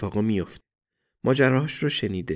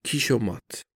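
put-on light Eastern European accent on checkmate; slower than usual. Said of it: "یه نفر با لهجهٔ اروپای شرقی".

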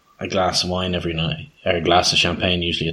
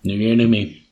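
mock slogan; 3 semitones higher, 4 dB louder than usual.

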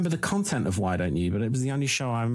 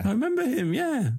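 high, eager recall; light up remembering him; excited. Real, not acted.

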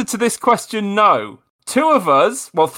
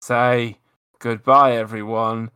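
low mock sign-off; 10 semitones lower, 3 dB quieter than usual. Repeated sign-off, lowered.

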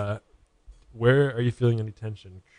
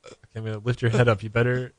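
ordinary talk, nothing marked

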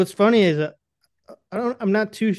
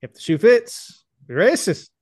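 higher, louder comic voice with heavy emphasis; Mark Norman; 4 semitones higher, 4 dB louder than usual. Retold or imitated. Friend imitating a comedian's quip, exaggerated emphasis. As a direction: punch each phrase like an over-emphatic stand-up. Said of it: "what Mark Norman would say".

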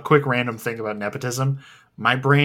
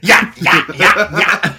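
excited yeah yeah; 9 semitones higher, 10 dB louder, slower than usual. Retold or imitated. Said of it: "you're like".

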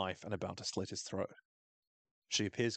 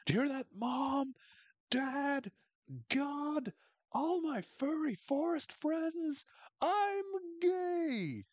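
very high, drawn-out hammed-up calling out; mock proclamation; much slower than usual. Coach coming out to parents, God and forest, proclaiming. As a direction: proclaim it slowly to the whole forest.

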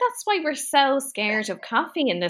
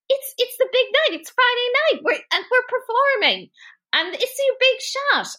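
higher, lightly acted urging; urgent pep talk; 10 semitones higher than usual. Zach urges dazed Jessie to perform, mock urgent rousing. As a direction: imitate a manager hyping his performer.

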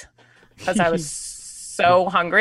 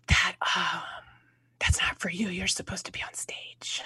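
hushed whisper, much softer, voice dropping out; retold whisper; 9 dB quieter than usual. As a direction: whisper the warning as if in a quiet theater. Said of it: "I just remember her whispering".

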